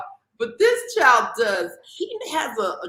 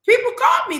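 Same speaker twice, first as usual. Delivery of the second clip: mock insistence; 12 semitones higher, 4 dB louder, faster than usual. Retold argument over phone calls.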